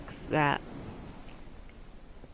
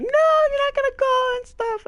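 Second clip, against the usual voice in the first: squeaky high mimicry of a nagging mother; mock her refusal in a shrill little voice; mocking mom voice; 6 dB louder than usual. Daughter mimics her mother forbidding the trip, squeaky high mockery. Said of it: "She was like".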